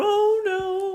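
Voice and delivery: high, sing-song mock wail; drawn-out comic lament; moaning. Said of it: "they're just moaning and going".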